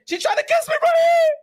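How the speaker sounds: very high hammed-up shriek; mock terror. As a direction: squeal it out like a horrified victim. Acting terrified victim reporting to police.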